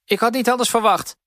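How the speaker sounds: a hammed-up old mistress grumbling. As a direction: grouse at the girl. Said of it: "bromde de oude mevrouw".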